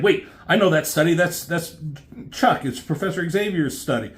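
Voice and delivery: high, fast, loud gasp of recognition; startled recognition. Retold or imitated. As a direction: realize it in a rush. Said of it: "He's like".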